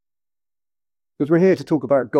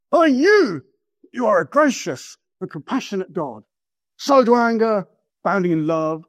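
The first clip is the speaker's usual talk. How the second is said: angry; 2 semitones higher than usual. Shown as a demonstration. Jonah throwing God's own qualities back at him, angrily. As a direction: spit the praise out as a complaint. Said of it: "angrily. Listen to this".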